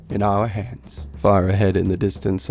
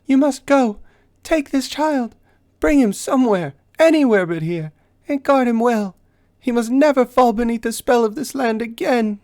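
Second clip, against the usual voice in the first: much higher, loud pleading orders; urgent command; 14 semitones higher, 4 dB louder than usual. Acted. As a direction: get them moving. Knight urges them to flee.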